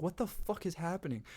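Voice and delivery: high-pitched bewildered question; retold confusion. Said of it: "so confused for a bit".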